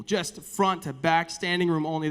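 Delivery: usual speaking voice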